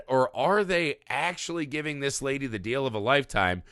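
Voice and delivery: ordinary talk, nothing marked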